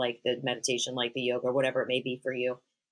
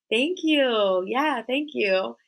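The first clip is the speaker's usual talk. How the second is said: slow, higher, sweetly gracious thank you; polite demo thanks; 7 semitones higher, much slower than usual. Shown as a demonstration. Mom showing daughter how to answer compliments, slow and gracious. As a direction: model the gracious reply slowly. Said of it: "You should say, like".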